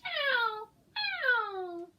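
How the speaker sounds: very high, loud meowing "Ow, ow"; high, loud cat call. Acted. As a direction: yowl it like a cat. Cat meows in picture book.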